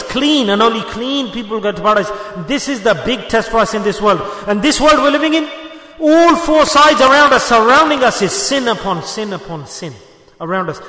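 ordinary talk

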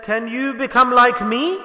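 pleading question. Retold or imitated. The alcoholic asking the saint a third time, slow pleading rise. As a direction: draw out each word in wonder. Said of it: "third time when he said".

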